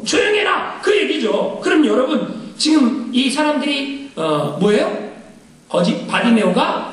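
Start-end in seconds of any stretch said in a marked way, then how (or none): 0.0-0.8 mock rebuke. Crowd hushing Bartimaeus, raised mock scold. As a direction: shush him like an annoyed crowd. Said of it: "이 얘기죠".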